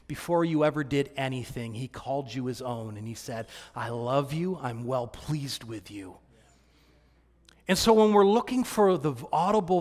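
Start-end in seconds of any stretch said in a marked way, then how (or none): 3.8-6.2 much softer, slow and tender; soft, slow loving murmur; tender hush. Acted. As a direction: speak it as a father's gentle reassurance. God's loving words to listener.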